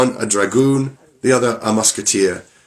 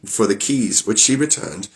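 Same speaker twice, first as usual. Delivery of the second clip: plain reading voice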